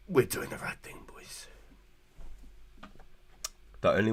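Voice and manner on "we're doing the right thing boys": whisper. Real, not acted. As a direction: lean in and whisper it. Whispered aside to viewers.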